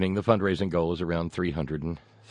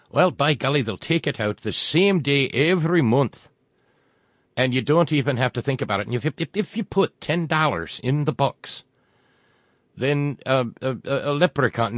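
a hammed-up, higher, louder Irish brogue; crummy Irish accent; 5 semitones higher, 5 dB louder than usual. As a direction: lay on a mock Irish lilt for the pitch. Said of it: "my crummy Irish accent yet".

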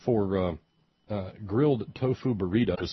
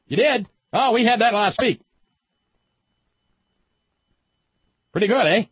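high, louder mock New England accent; Vermont accent; 12 semitones higher, 9 dB louder than usual. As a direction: chirp it like a breezy Vermonter. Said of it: "Whatever the accent is".

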